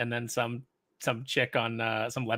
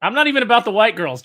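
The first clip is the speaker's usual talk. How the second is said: emphatic; 7 semitones higher, 9 dB louder than usual. Real, not acted. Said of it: "repeats with less force".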